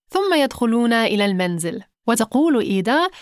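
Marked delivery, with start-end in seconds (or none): none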